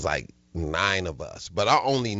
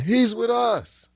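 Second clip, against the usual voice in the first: high, slow, sheepish reassurance; hammed-up friend; slower than usual. A friend telling the fleeing comedian, high and slow. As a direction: explain it away slowly.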